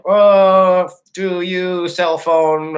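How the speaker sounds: no Japanese yet, he talks slowly and loudly to the caller; slow broken English. Retold or imitated. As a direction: spell it out slowly for a foreigner.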